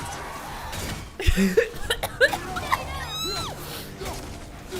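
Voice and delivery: high shrieking laugh, real; can't hold back the laugh; loud burst of laughter. Real, not acted. Streamer cracking up loudly at a viewer's misspelled insult.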